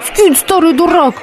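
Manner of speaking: mock the old fool; heckler. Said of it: "с другой". (выше, насмешливый выкрик)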